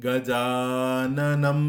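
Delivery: গজাননম very slow and loud, each syllable given its length; long and short syllables. Shown as a demonstration. Teacher showing syllable length in গজাননম, slow and loud. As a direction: stretch গজাননম, long vowels held. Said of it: "অর্থাৎ".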